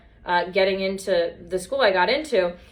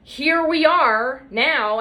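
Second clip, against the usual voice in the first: dramatic; 5 semitones higher, 4 dB louder, much slower than usual. Real, not acted. Dramatic slow opener.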